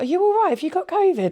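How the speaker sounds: high, concerned voices; imitating other parents' alarm; mock concerned parents. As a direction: fuss like worried school parents. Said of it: "everyone was like".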